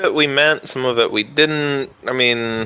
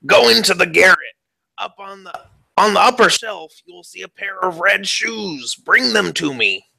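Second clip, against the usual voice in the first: raised pitch shoemaker voice; high character voice; 7 semitones higher than usual. Acted. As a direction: order her about in a high voice. Shoemaker voice giving orders.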